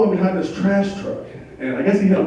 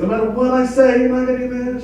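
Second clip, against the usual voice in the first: high-pitched, drawn-up questioning; wary double-checking; 9 semitones higher than usual. Acted. Husband making wife confirm her promise, warily double-checking. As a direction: press for reassurance in a raised pitch.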